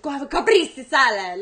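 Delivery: put-on showy, loud Italian-style accent on "caprese salad"; pretentious foreign accent. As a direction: overpronounce it like a show-off, accent laid on thick. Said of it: "that asshole that's like".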